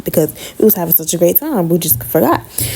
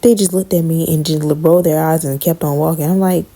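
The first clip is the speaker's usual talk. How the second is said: usual speaking voice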